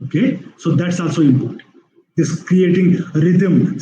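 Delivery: ordinary talk, nothing marked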